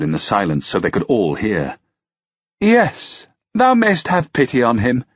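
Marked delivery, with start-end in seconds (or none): 2.6-5.0 high, sweetly mocking lady's voice. A narrator's reading. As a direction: taunt him with fake mercy. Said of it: "with a mocking bow".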